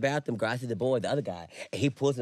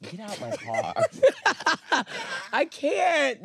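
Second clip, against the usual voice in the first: laughing; 12 semitones higher, 4 dB louder, much slower than usual. Real, not acted. Laughing at story.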